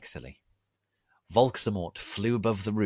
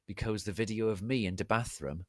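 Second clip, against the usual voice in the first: ordinary delivery, nothing marked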